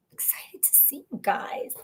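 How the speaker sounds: hushed after yelling; whisper. Real, not acted. Apologizing for yelling, now whispering. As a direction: drop to a whisper. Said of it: "Sorry, I'm yelling".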